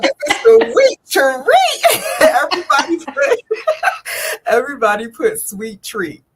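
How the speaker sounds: loud high laughing delivery. Real, not acted. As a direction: laugh through the words. Laughing while hyping.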